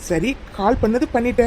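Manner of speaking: usual speaking voice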